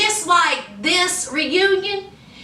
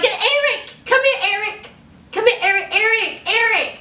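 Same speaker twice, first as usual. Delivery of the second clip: high-pitched mock call to someone offscreen; imitated calling out; 7 semitones higher than usual. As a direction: call him over in a high, put-on voice. Imitates woman calling boyfriend over.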